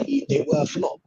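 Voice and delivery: usual speaking voice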